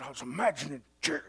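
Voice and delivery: soft, quick, hushed imitation of Todd; Todd's voice. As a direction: murmur it like calm Todd. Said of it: "he talked like this".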